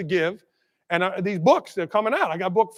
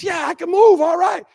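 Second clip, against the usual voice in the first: happy bird; 10 semitones higher, 4 dB louder than usual. Acted. Bird revives in joke.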